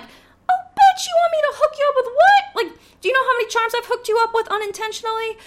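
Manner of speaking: very high, loud sassy comeback, fast at the end; sassy outraged retort. Retold or imitated. Clerk imagining telling off the thief, high and sassy. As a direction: snap back with outraged sass. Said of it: "And I'm like".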